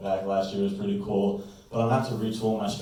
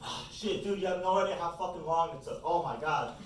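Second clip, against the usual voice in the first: high, much softer, breathy-free exasperation; frazzled Home Depot guy; 7 semitones higher, 8 dB quieter than usual. Retold or imitated. Late painter complaining to the client, high and soft. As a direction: grumble like a worn-out young worker. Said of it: "he's just like".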